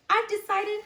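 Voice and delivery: eager high declaration; bright announcement. Acted. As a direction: pitch jumps way up on decided. Character announces big decision.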